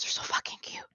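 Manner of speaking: hushed gush; whisper. Real, not acted. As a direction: whisper it excitedly.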